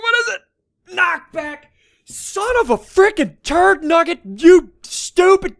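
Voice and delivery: high, loud, drawn-out exasperated cursing; angry outburst. Real, not acted. Player cursing a knockback enchantment, angry outburst. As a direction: explode at the rotten result.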